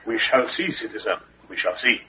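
low, quiet menace; veiled threat. Acted. Suspicious guard to a traveller at the gate, quietly menacing. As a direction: drop it low and soft, ominously.

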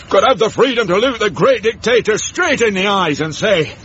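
high, grand orator's voice; rally the troops with mock pride; hammed-up war-hero speech; pompous patriotic speech. Uncle Mort's mock patriotic oration.

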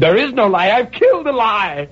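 a high, furious denial. A narrator's reading. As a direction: scream it out in rage. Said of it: "I stamped on the floor and screamed".

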